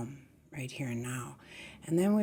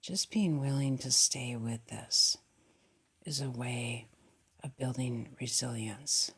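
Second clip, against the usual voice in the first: soft, slightly lowered meditation voice; hushed, settled, calming guidance; guided meditation; 3 semitones lower, 4 dB quieter than usual. Acted. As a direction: guide the breath gently, leaving long pauses.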